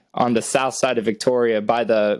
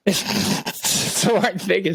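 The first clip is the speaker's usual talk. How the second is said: laughing; 4 semitones higher, much slower than usual. Real, not acted. Teasing about the punch.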